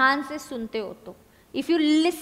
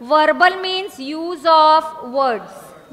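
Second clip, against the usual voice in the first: very slow, word by word, a little louder; slow dictation; 5 dB louder, much slower than usual. Shown as a demonstration. Teacher dictating a definition, very slow. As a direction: spell it out slowly for note-taking. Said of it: "मैं लिखती हूँ, देखो".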